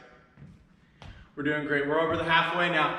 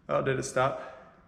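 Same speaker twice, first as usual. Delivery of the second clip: quiet aside; 5 semitones lower, 10 dB quieter than usual. Real, not acted. Fixing stopped timer aside.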